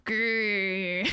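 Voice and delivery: mock little growl; half-hearted growl; weak growl. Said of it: "I'm like".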